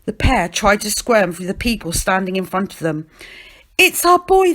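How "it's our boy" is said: high, loud appeal to the crowd; urgent cry. A narrator's reading. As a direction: cry out to be let through. Pushing through crowd to their boy.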